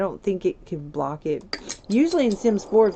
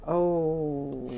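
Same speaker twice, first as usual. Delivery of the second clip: ordinary delivery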